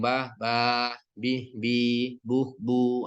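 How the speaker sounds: each syllable short, then held long: ba, ba, bi, bi, bu, bu; short then long vowels. Shown as a demonstration. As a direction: clip the first, stretch the second in "ba, ba, bi, bi, bu, bu". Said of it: "baik dia pendek atau panjang". ("ba, ba, bi, bi, bu, bu" pendek lalu panjang)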